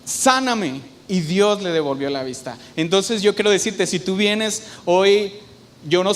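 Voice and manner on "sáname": higher-pitched mock shout, no real volume; imitated pleading cry. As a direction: retell a blind man's cry for mercy, pitched up. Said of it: "gritaba y decía".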